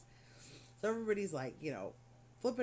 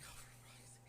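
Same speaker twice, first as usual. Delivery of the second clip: whispered groan; exasperated whisper; 18 dB quieter than usual. Retold or imitated. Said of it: "I'm like".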